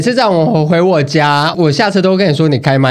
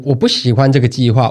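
usual speaking voice

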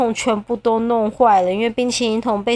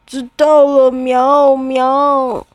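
contrite cat; 2 semitones higher, 5 dB louder, much slower than usual. Acted. 猫猫认错.

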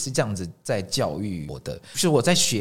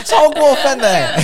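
slow words through laughter; laughing; 11 dB louder, slower than usual. Real, not acted. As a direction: say it while still laughing. Laughing at friend's rude question.

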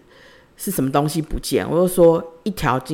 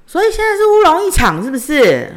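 much higher, louder mock voice of someone far back; distant voice; 13 semitones higher, 6 dB louder than usual. A faraway bystander on the call, mockingly imitated. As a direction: imitate a distant voice cutting in from behind. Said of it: "有一点远啦，有一点点回音".

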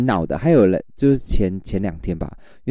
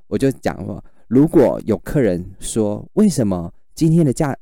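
ordinary delivery

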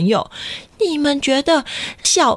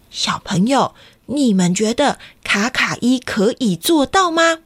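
ordinary delivery, nothing marked

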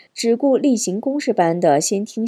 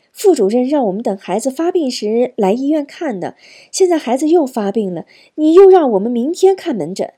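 higher-pitched complaint, building louder; indignant protest; 4 semitones higher, 4 dB louder than usual. Acted. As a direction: argue back, rising in exasperation. Mother protests to the doctor. (据理质问，越说越激动)

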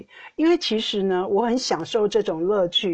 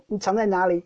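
usual speaking voice

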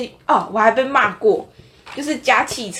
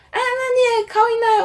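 mock old man voice; 14 semitones higher than usual. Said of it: "他说".

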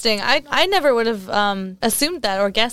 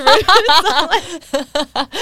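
laughing; 7 semitones higher, 6 dB louder, much slower than usual. Real, not acted. Laughing after her story.

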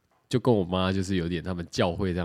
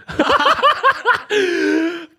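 long high loud burst of laughter; 7 dB louder than usual. Real, not acted. Laughing at the elder asking to add LINE, loud high laughter. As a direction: crack up helplessly.

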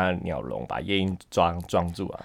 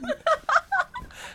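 high giggle; 4 dB quieter than usual. Real, not acted. Laughs at own singing.

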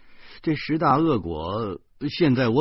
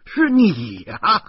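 得意揭穿; 8 semitones higher, 7 dB louder, much slower than usual. A narrator's reading. 叙述者揭穿诗谦.

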